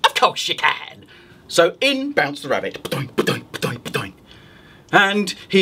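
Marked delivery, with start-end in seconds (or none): 0.0-1.0 sly witch reply. Acted. Witch to the rabbit, sly agreement. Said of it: "the witch said". 2.8-4.1 soft bouncing sounds. A narrator's reading.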